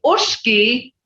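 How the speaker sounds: ushki with a short vowel and long "sh"; Creek pronunciation. Shown as a demonstration. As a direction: say ushki as "oosh-kee", hushing the middle. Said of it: "Hear that tone?".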